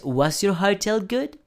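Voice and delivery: "was your hotel good?" rising on "good"; question intonation. Shown as a demonstration. Teacher modeling a yes-no question rise. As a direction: lift "good" at the end like a question. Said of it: "Y la pregunta es".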